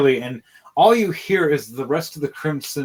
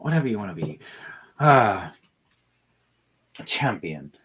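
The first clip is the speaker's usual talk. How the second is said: unbothered demon; 2 semitones lower, 3 dB quieter, slower than usual. Acted. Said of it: "he just looks over going".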